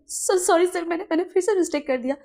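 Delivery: high, fast panicked apology; flustered servant. Acted. Servant apologising to his boss, high and flustered. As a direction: rush the sorry in a high voice. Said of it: "wing बोलता है".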